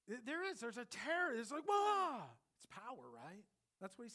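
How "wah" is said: put-on a short high wail like a scared kid; sudden loud child's cry of fright; mock child wail. Imitating a terrified child.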